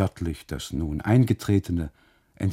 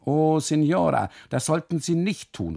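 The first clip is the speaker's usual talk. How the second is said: tearful plea; 8 semitones higher, 4 dB louder than usual. Acted. Custodian reproaching the wife, wailing. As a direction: wail it with hurt. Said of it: "ruft er".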